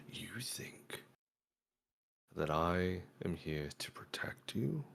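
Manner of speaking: slow whispered villain threat; menacing hushed villain voice; villain monologue. Retold or imitated. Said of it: "I'll evil guy monologue".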